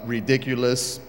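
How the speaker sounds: ordinary talk, nothing marked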